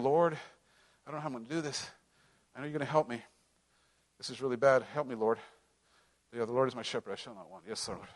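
low, soft, pleading prayer to the Lord; worried prayer. Retold or imitated. A worried believer softly praying to the Lord. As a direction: pray it low and softly, half to yourself.

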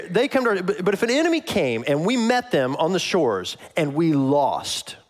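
ordinary talk

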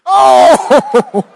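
loud high burst of surprise; real shocked exclamation; startled Oh; surprised shout. Real, not acted. Reacting to interpreter's slip.

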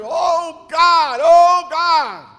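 loud desperate cry to God; yelling to God. Retold or imitated. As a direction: cry out high and loud, pleading. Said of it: "Yelling to".

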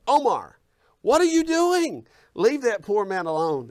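high, loud, drawn-out reprimand; mock scolding yell. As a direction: imitate a woman yelling at a child from across the room. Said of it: "this woman yells and says".

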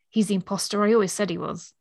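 ordinary talk, nothing marked

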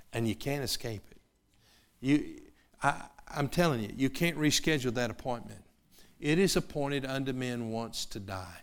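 ordinary talk

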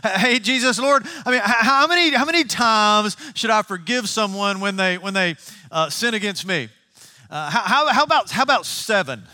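a keen disciple pitching a number he's proud of; eager Simon Peter. Acted. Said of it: "Simon Peter comes to him and says".

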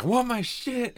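very high imitated demand; mock angry yell. Someone pounding on the truck window, high-pitched mock yell. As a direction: squeal it like someone shut out.

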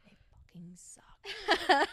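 high, breathy whispered aside; mock whisper. A pretend employee whispering to the podcast hosts, mock whisper. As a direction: drop to a secret whisper. Whispered end of the imitated rant.

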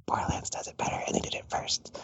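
very soft, unvoiced delivery; hushed retort. Imagined reply to a Twitter critic, hushed retort. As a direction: say it under your breath, almost whispered. Emphatic aside answering the critic.

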